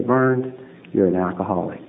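soft, low and gentle. Retold or imitated. An inner voice speaks gently to Burns, soft and low. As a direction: say it like a quiet inner voice. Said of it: "that gentle voice".

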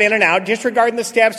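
normal talking voice

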